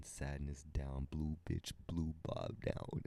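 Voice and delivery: ordinary delivery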